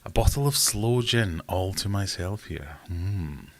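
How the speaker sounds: normal talking voice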